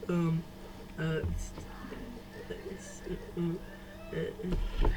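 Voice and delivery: stuck on broken sounds, very quiet; imitated stuttering. Retelling the attempt to talk to a friend, imitated stutter. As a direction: act out being unable to get a word out. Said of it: "I couldn't even talk".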